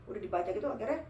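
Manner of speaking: ordinary delivery, nothing marked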